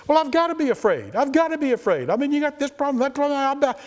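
high, whiny voice of a fretting believer; worried believer voice. Retold or imitated. Preacher mimicking a fretful churchgoer, high and whiny.

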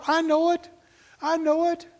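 high-pitched concession. Acted. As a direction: grant the point in a high voice. Preacher answers the voiced objector.

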